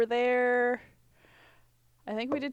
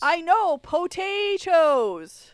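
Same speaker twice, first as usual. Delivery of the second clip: drawn-out whine; 8 semitones higher, 5 dB louder, much slower than usual. Acted. Drawn-out mock insistence.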